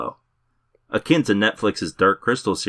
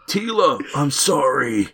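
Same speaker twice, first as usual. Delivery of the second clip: groggy dying hero; 8 semitones higher, slower than usual. Retold or imitated. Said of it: "All while being like".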